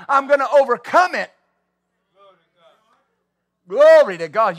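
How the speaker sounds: preaching. Acted. Preaching build to shout.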